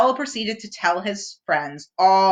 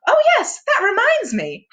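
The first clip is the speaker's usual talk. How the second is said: high cheerful bear voice; brightly remember like Pooh; 14 semitones higher, 5 dB louder than usual. A narrator's reading. Pooh voiced in read story.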